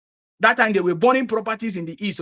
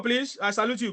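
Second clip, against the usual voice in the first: ordinary talk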